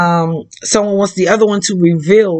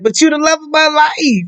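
high-pitched lovesick protest; mock pleading; 6 semitones higher than usual. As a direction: plead like a wounded lover, hammed-up. Acts pleading lover in retold exchange.

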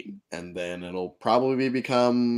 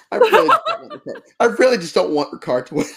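laughing; 12 semitones higher, 5 dB louder, faster than usual. Real, not acted. Laughing plea.

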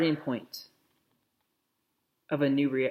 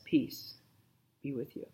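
whispered, gentle words of peace; Jesus blessing the listener in a whisper; hushed blessing; 5 dB quieter than usual. Acted. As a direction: let the blessing fall to a whisper. Preacher voices Jesus' blessing.

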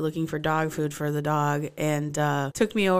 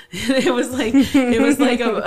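laughing; 6 semitones higher, 8 dB louder than usual. Real, not acted. Laughs mid-story.

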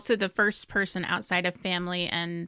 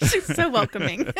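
laughing sarcasm; 5 semitones higher than usual. Real, not acted.

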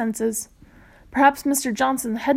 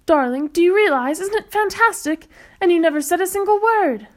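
thrilled, much higher and louder; 6 semitones higher, 4 dB louder than usual. Acted. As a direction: gush with delight. Thrilled mother gushes.

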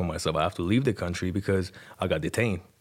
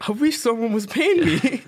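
much higher and louder, mocking; sarcastic retort; 6 dB louder than usual. Teen mocking his interrogators, sarcastic and high. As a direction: toss it back with cheeky sarcasm.

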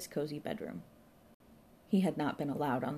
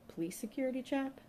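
high-pitched disbelieving question; puzzled repeat as a question; incredulous echo; 9 semitones higher than usual. A narrator's reading. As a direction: echo it back, baffled, pitch climbing. Inspector echoes her in disbelief.

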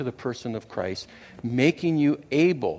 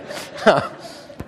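short laugh. Real, not acted. Laughing after teasing Kylie.

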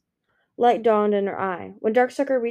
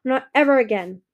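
loud menacing snarl; 4 semitones higher, 6 dB louder than usual. A narrator's reading. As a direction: snarl it as a vow. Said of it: "Darkstalker snarled".

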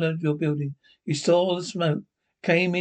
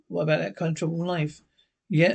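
normal talking voice

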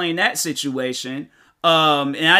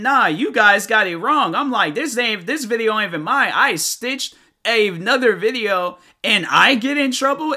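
higher-pitched, aggrieved pushback; indignant protest; 5 semitones higher, 3 dB louder than usual. Retold or imitated. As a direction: argue your case in a raised pitch. Retells protesting the account takedown.

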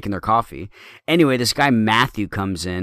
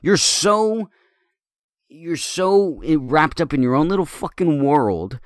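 retold angry telling-off of a customer; indignant outburst; 5 semitones higher than usual. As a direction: let the outrage spill out at him. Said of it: "I'm like".

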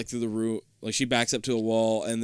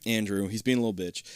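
ordinary talk, nothing marked

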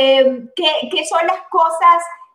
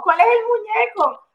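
surprised; 6 semitones higher than usual. Real, not acted. Host startled by a doll.

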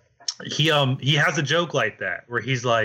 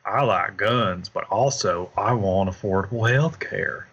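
cool Southern type; 4 semitones lower than usual. Retold or imitated. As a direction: lightly lay back like a laid-back Texan. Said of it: "the cool Southern type".

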